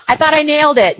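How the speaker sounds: laughing. Real, not acted.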